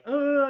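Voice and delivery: high, drawn-out wailing moan; eerie imitation of a wail; imitated moan. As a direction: moan high and long like a crying ghost. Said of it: "it's doing its moan".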